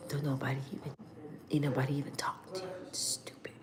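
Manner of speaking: whispered, fast put-down; mimicking the brother's whispered jab. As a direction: hiss the insult under your breath like him. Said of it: "he talks like this all the time".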